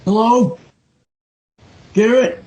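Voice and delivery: usual speaking voice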